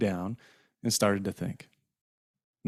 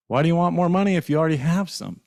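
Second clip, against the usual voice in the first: higher-pitched, mildly angry demand; angry father; 7 semitones higher, 4 dB louder than usual. Acted. Dad confronting his son over the money, angry father. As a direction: demand it with raised pitch, annoyed. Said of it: "started to get angry again".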